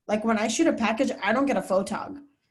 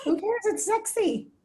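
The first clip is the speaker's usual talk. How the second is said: much higher voice, light and quick to reassure; high-pitched encouragement; 11 semitones higher than usual. Real, not acted. Speaker encourages student shy about her accent, high-pitched encouragement. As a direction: squeal it to cheer on someone shy.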